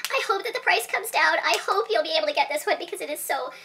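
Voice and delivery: ordinary talk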